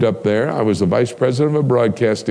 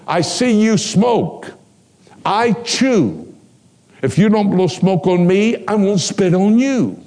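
mock threat; 6 semitones higher, slower than usual. Threatening the smoking prisoner.

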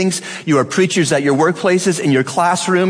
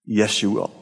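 quiet answer; 7 semitones lower, 6 dB quieter than usual. Real, not acted. Answers own question to congregation.

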